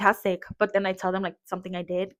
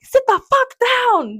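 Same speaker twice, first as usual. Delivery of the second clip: mock mom yell; 7 dB louder, slower than usual. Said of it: "So when I'm like".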